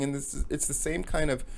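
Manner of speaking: usual speaking voice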